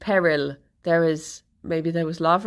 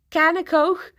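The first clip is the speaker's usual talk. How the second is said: higher, Liverpudlian "can of Coke"; Liverpudlian accent; 9 semitones higher than usual. Retold or imitated. Friend asking for a snack, in a Liverpudlian accent. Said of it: "in a Liverpudlian accent".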